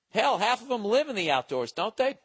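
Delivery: put-on Southern accent. Teasing Southerners in a high, loud Southern accent. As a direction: keep the hammed-up Southern accent going. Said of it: "in a Southern accent".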